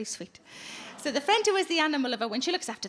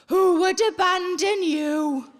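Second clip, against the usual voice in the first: slow, higher, louder doting coo; mock lovestruck boyfriend to the cat; imitating fella; 4 semitones higher, 6 dB louder, much slower than usual. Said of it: "he looks her right in the face and he goes".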